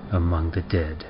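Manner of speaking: plain reading voice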